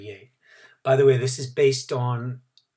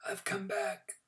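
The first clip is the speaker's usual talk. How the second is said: whispered; 8 semitones higher, 10 dB quieter than usual. A narrator's reading. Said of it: "he said".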